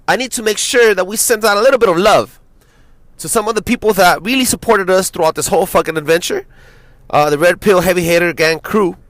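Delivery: loud, fired-up radio host shout-out. Acted. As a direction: hype up the thank-yous like a DJ. Shout-out to supporters.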